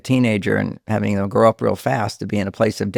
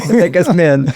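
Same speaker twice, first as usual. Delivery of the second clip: louder, higher, still laughing; laughing punchline; 6 semitones higher, 6 dB louder than usual. Real, not acted. Joking that marriage turns husbands into men, laughing. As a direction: toss the line out through laughter.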